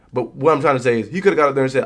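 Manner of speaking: ordinary talk, nothing marked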